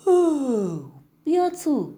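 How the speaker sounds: yawning sleepy mouse; drowsy little mouse, a touch of a yawn. Acted. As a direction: call out half-asleep. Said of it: "با صدای خوابالودی گفت".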